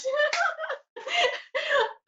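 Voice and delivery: very high squealing laugh; cracking up. Real, not acted. Host amused by her own impression, high squealing laugh.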